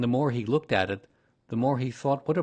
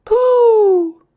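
put-on very high, very loud child's shout; joyful cry; 18 dB louder than usual. A narrator's reading. Christopher Robin finds Pooh behind him, high joyful shout. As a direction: shout with delight at finding a friend. Child greets his found friend.

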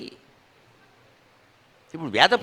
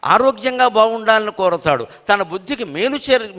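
usual speaking voice

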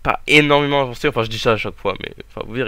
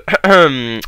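clearing throat; 2 semitones higher, 10 dB louder than usual. Real, not acted. Sick player clears throat.

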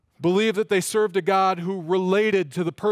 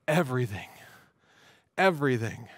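"everything" set apart, low and weighty; resounding; 5 semitones lower than usual. Real, not acted. Preacher answering his own question to the congregation, resounding "everything". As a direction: drop low and let "everything" stand alone. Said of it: "the answer is a resounding".

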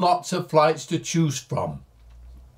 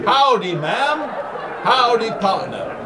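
a playful, laughing Texan howdy; mock Texan; 5 semitones higher than usual. Greets viewers in a new cowboy hat, mock Texan. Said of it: "I think that's how they say it".

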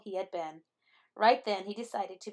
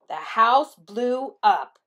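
dramatic blast; 9 dB louder, much slower than usual. A narrator's reading. Dramatic explosion in story.

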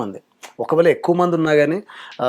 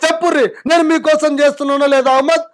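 much higher, louder politician's speech voice; a chief minister pleading his case to the crowd, loud and high; Revanth Reddy impression; 11 semitones higher, 6 dB louder than usual. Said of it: "Telangana CM Revanth Reddy గారి voice".

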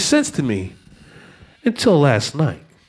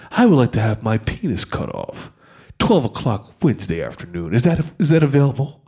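lower, softer, nice and soothing; 10 semitones lower, 3 dB quieter than usual. Retold or imitated. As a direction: coo the request gently. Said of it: "very nice, soothing, soothing tone".

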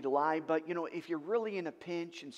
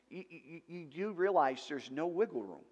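normal talking voice

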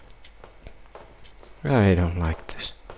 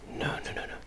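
whispering; 17 dB quieter, much faster than usual. Real, not acted. Whispering in dread.

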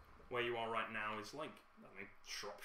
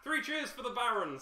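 high, loud call to the room; bartender's toast; 12 semitones higher, 9 dB louder than usual. Acted. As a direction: raise a toast to the Baron. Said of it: "says the bartender".

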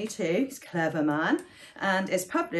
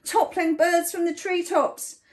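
ordinary delivery

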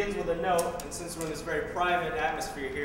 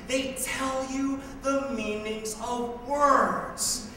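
very slow, drawn-out mock lesson; patronizing, stretched words; condescending explanation; 8 semitones higher, slower than usual. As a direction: spell it out as if to someone clueless. Mock lesson to ignorant addressee.

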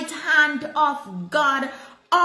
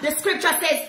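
ordinary talk

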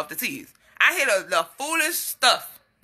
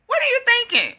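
higher first take; 13 semitones higher than usual. Real, not acted. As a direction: throw the question up high.

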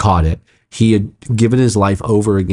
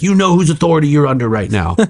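high-pitched retold rebuke; freaked-out command; 7 semitones higher than usual. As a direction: pull rank in a high, urgent voice. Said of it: "I was freaked out".

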